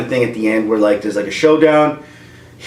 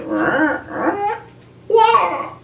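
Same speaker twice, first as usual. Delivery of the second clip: mock wail. Thrown, flailing.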